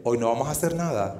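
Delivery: a cool, quiet brush-off; serious girl. Retold or imitated. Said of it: "te mira toda seria, te dice". (la chica, seria y fría, lo deja plantado)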